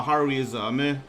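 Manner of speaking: ordinary talk, nothing marked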